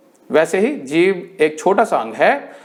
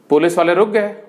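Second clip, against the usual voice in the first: ordinary delivery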